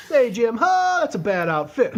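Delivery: high, loud pimp call with a laugh; repeating the pimp line to himself as a kid. Retold or imitated. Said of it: "I'd say it at varying speed".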